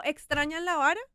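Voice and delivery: put-on half-joking warning to unruly children; mock scolding threat. Mother scolding the kids.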